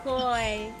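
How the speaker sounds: ordinary delivery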